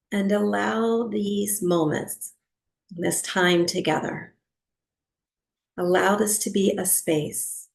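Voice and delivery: unhurried meditation guide, long pauses between phrases; guided meditation. Real, not acted. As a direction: settle listeners into stillness, phrase by phrase.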